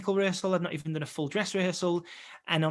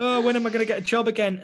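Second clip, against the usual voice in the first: mock despair; 5 semitones higher, 5 dB louder than usual. Mock despair after expected rejection.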